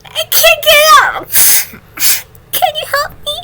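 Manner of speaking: loud crying call for help. Acted. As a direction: cry out for dad. Sick child calling dad.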